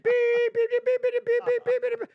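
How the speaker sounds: rapid string of high mock beeps; high robotic bleeping; censor bleeps. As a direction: mimic a censor tone going off repeatedly. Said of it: "I bleeped it every single time".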